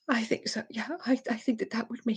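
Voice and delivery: put-on half-whispered like a tearful little girl; mock sniffly little voice; imitated crying. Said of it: "she's just crying".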